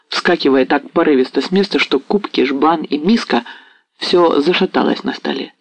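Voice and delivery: ordinary delivery, nothing marked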